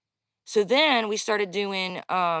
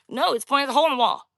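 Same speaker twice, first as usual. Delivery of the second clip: loud, fast, insistent retort; insisting; 5 semitones higher, 5 dB louder, much faster than usual. Retold or imitated. To the twelve-year-old who denies it, a loud fast insistence. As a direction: cut her off and insist she's wrong.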